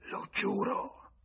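put-on very high, slow rasping old voice; hoarse oath. A narrator's reading. Withered woman hoarsely swears to James. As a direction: croak the vow out. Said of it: "venne l'eco rauca".

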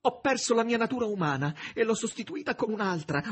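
high, loud, wounded woman pouring out her pain; Margherita's anguished reproach. A narrator's reading. Margherita rebuking the master who sends her away, anguished and loud. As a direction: let it spill out, raw and aching, close to tears.

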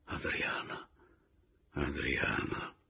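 soft slow whispered name; whispered longing. A narrator's reading. The narrator yearns for Adriana after the kiss. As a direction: murmur her name with longing.

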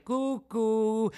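high sing-song cucù teasing; cheeky puppet jeering cucù; mocking cuckoo. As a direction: taunt like a cuckoo clock. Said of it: "in segno di canzonatura".

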